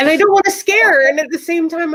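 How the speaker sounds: high, tearful panic being acted out; tearful retelling. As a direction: retell it high-pitched, as if about to cry. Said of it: "now I'm crying".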